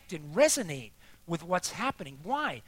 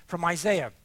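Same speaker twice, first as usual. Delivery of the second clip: normal talking voice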